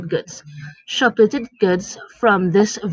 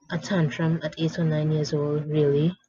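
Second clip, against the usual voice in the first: plain reading voice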